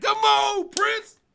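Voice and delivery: high, loud hype call; real excited shout. Real, not acted. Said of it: "cheering the song".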